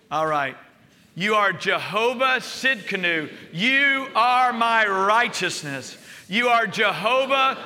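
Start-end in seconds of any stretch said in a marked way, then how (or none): 1.1-3.3 louder, higher, slow proclaiming of God's names; loud group declaration. Real, not acted. Pastor leading church in standing declaration, loud and slow. Congregation declaration, preaching at full force. 3.5-6.0 louder, slow proclaiming; loud declaration. Shown as a demonstration. Pastor leading the standing church, loud and slow. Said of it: "If you wanna stomp your foot".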